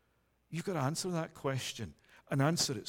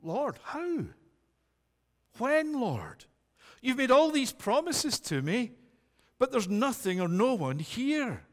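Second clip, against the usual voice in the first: higher, urgent pleading voice; pleading Abram; 5 semitones higher than usual. Acted. Abram questioning the Lord, pleading and higher. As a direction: cry out to the Lord, anxious. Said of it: "imagine him at this point saying".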